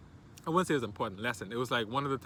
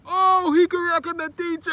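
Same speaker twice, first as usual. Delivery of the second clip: very high, loud, drawn-out crowd holler; mock classroom uproar, stretched long; students' jeering outcry; 13 dB louder, slower than usual. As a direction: whoop it like a class catching the teacher out. Imitating students jeering at teacher's mistake.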